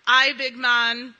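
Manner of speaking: put-on loud, slow stab at a Scottish accent; attempted Scottish accent. Church member quotes a client's prayer, attempted Scottish accent. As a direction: imitate a Scottish accent, badly, knowing it falls short. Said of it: "prayed in her awesome Scottish accent".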